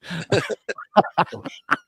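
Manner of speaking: high-pitched burst of laughter. Real, not acted. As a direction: laugh at your own joke. Laughs at his own retold joke.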